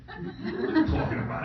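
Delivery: whispered, high, laughing disbelief; a hushed, amused what-are-you-on-about; mock incredulous. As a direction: scoff at the idea. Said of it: "own retorted reply".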